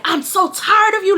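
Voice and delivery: high-pitched exasperated mom imitation; lecturing mom. Mom dragging her child downstairs, lecturing. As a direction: imitate a fed-up mother. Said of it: "she's giving me a lecture".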